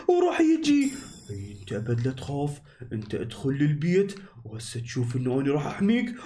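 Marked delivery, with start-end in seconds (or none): 1.3-6.1 much lower, reassuring dragon voice; protective dragon. Acted. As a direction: promise protection from deep down.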